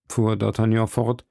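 usual speaking voice

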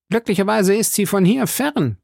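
high-pitched blustering musketeer; Porthos. Acted. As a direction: pitch it well up, mildly reproachful. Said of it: "sagte Porthos". (hohe, polternde Musketierstimme)

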